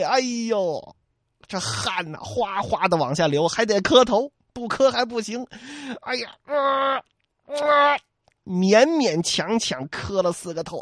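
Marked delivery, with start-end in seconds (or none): 6.0-8.0 pained groan. Acted. Kneeling with aching back.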